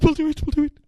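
high, urgent double yes; eager echo. Retold or imitated. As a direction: rush to agree right after him. Wife echoes the husband's agreement.

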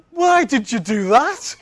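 laughing quote. Retold or imitated. Crowd staring at him.

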